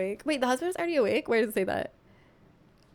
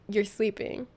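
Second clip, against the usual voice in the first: usual speaking voice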